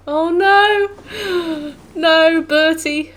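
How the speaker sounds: high, loud shocked cry; shocked dismay. Real, not acted. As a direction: gasp and cry out in horror. Streamer reacts to shocking event.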